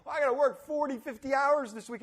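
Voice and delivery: a moaning worker griping about hours; whiny complainer. Retold or imitated. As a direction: mimic a self-pitying worker. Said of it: "I hear people say".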